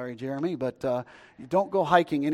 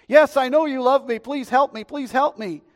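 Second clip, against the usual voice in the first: higher, insistent acted begging; desperate pleading; 9 semitones higher, 7 dB louder than usual. Hiker pleads with the voice from the sky, desperate. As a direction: keep begging, urgent and high. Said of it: "Help me, somebody out there".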